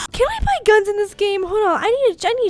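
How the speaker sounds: indignant yell about buying guns; outraged outburst. Real, not acted. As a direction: blurt it out fired up.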